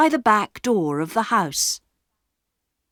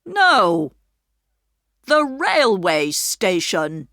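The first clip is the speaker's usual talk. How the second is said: louder, firm man's voice; stern station master; 4 dB louder, slower than usual. A narrator's reading. Station master to Peter, sternly. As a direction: lay down the law. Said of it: "said the man".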